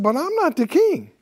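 high-pitched, lightly acted protest; balking underling. Secretary Bob protesting to the king, balking.

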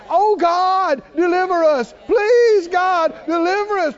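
high, loud pleading cry; begging. Retold or imitated. Preacher voices Israelites begging God for deliverance, pleading. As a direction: mimic desperate pleas to God. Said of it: "they begged and they pled".

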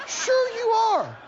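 high, stretched-out taunt; taunting insistence. Acted. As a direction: needle them with slow, high certainty. Devil taunts, acted quarrel.

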